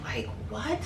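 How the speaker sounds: incredulous whisper; disbelief. Real, not acted. Telling viewers about the man at her door, whispered disbelief. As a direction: breathe it out in stunned disbelief.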